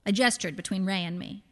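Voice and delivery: ordinary delivery, nothing marked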